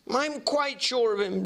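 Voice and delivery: Jekyll's voice. Acted.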